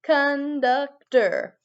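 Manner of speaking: very slow, each syllable clear; slow drawn-out model word. Shown as a demonstration. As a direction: stretch out Conductor. slowly for kids to repeat. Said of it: "Can you say that with me".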